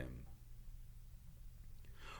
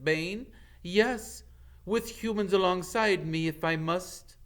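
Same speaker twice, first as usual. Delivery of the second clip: loud, higher furious declaration; angry defiance; 10 semitones higher, 7 dB louder than usual. Acted. Firenze defying Bane, loud and angry. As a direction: stand your ground and shout it.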